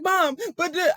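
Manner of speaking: put-on high-pitched pleading child; imitated near-tears protest. Pinned son appealing to Mom, mock tearful high whine. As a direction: whine it like a boy about to cry. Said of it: "he was about to cry".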